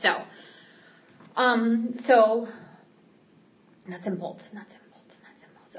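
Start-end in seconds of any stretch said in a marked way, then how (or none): 4.5-5.8 trailing off into a whisper to herself; fading whisper. Real, not acted. Regathering her thoughts mid-story, fading whisper. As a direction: mutter it under the breath until it vanishes.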